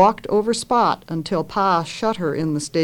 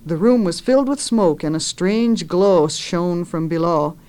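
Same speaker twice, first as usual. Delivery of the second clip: ordinary delivery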